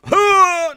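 shrill piercing squeal noise; high squeal. Pretend. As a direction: let out a high goofy squeal. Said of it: "I like making the noises".

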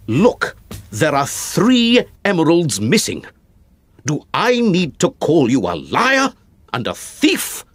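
angry cry. Acted. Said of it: "I cried".

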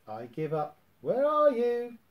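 Tigger calling. A narrator's reading. Tigger calls for the hidden Roo, loudly. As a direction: call into the trees. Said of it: "Tigger shouted".

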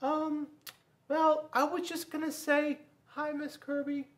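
put-on high, timid child's voice; shy boy. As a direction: nervously greet the teacher. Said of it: "Bobby said".

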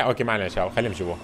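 usual speaking voice

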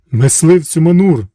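loud chorus of forty servants; shouted in unison. A narrator's reading. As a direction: boom out the master's title. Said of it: "крикнули вони в один голос".

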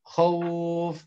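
khawf with the aw drawn out; khawf held long. Shown as a demonstration. Teacher showing students a layin word, khawf held long. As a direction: stretch the vowel in khawf. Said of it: "seperti itu".

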